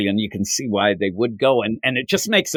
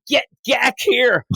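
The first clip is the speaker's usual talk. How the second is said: slow high demand; 11 semitones higher, slower than usual. Retold or imitated. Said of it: "he's like".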